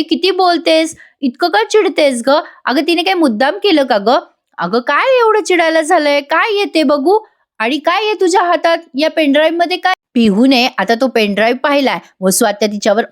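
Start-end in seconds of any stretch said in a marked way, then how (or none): 0.0-9.9 higher-pitched, questioning reproach; lightly acted girl taking someone to task; mild protest. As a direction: question her insistently, pitch held high. Girl protests at cousin's scolding.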